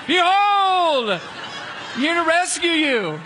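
high, loud, slow grand herald cracking into laughter; mock-grand angel, giggling through it; hammed-up heavenly rescue, laughing. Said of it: "he appears like".

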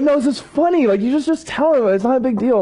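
imitating wife in a high voice; high, amused brush-off; dismissive wife. Wife laughing off his worry.

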